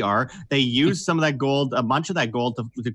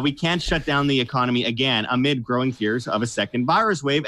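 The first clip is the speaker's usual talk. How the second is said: ordinary talk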